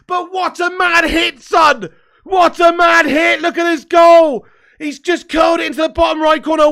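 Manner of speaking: goal celebration shout, high and loud; long goal yell. Real, not acted. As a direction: roar it like a commentator after a screamer. Replay of the Iran goal.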